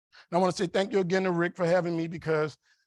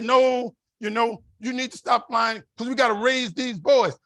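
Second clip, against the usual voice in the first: imitated wife, higher and louder; insistent wife; 5 semitones higher, 5 dB louder than usual. Wife telling husband to stop flying, loudly insistent. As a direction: put your foot down in a raised voice.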